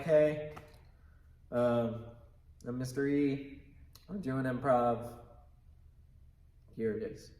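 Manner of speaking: low-energy, halting delivery with long pauses; boring non-character. Acted. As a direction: play an uninspired improviser with nothing going on. Demonstrates boring performer without a character.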